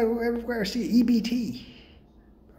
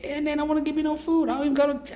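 normal talking voice

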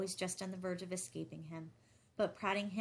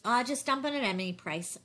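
louder, higher, offhand irritation; casual irritation; 5 semitones higher, 9 dB louder than usual. A narrator's reading. Gus plays Morgan on the phone, casually irritated. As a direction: brush it off as an irritated tycoon. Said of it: "Gus said with casual irritation".

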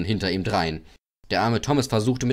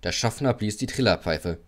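plain reading voice

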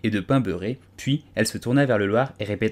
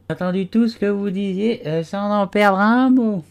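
a dozy little animal insisting it was awake; sleepy Dormouse; 10 semitones higher, 5 dB louder than usual. A narrator's reading. Dormouse defending himself to the tea party, drowsy and high.

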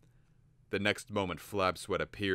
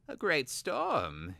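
high, slow feigned innocence; lightly voiced schemer playing innocent; innocent Vilnix; 9 semitones higher, much slower than usual. A narrator's reading. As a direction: sound harmlessly curious. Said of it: "he said innocently".

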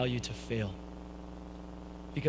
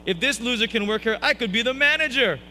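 higher, louder mock-cocky outburst; cocky boast; 8 semitones higher, 6 dB louder than usual. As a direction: brag it out, voice raised. Acted cocky boast in retold story.

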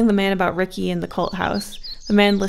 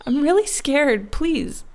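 high, slow and fearful, trailing off; scared plea; 7 semitones higher, 4 dB louder, slower than usual. A narrator's reading. Frightened girl begs her angry friend to stop. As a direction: plead through held-back tears. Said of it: "I trailed off".

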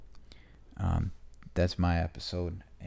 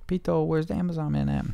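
pet talk; 10 semitones higher than usual. Real, not acted. Asking the cat.

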